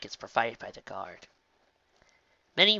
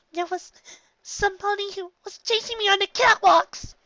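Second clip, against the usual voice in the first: put-on very high, loud child's wail; half-crying shout; 8 dB louder than usual. A narrator's reading. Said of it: "Nyx shouted, half cried".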